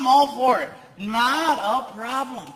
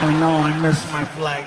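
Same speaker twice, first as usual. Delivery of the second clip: sheepish; 8 semitones lower than usual. Retold or imitated. Self voiced low.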